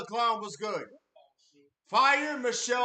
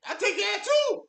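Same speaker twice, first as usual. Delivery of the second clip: laughing agreement; 12 semitones higher, 6 dB louder than usual. Real, not acted. Reading chat, agreeing.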